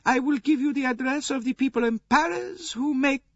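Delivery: very high-pitched grande dame voice; imperious old princess, assured. A narrator's reading. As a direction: make your case as a confident old princess.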